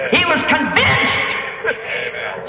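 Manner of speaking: high, very drawn-out exclamation; stretched, high revival shout; impassioned preaching. Real, not acted. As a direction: cry out the climax from the pulpit. Preaches the climax.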